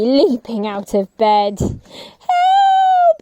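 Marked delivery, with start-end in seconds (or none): 1.6-3.2 very high, loud scream of help; frightened girl screaming help; high cry for help. Acted. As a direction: shriek for help in terror.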